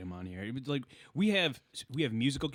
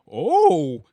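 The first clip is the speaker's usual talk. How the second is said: loud southern "oh", pitched up; southern accent; 4 semitones higher, 13 dB louder than usual. Retold or imitated. Comic stepping back after a missed joke, mock southern accent. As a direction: imitate a comic turning southern. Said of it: "He went back into his southern accent".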